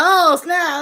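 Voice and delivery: mock stunned gasp, pitched high; imitating his shock. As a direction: play the man caught off guard, high and loud. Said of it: "He would've been like".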